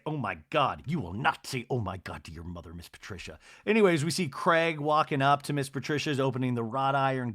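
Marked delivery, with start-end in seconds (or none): 0.0-3.4 low, soft mock scolding; Whitney. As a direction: imitate a son's deadpan reprimand, dropping away. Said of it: "And Whitney's like".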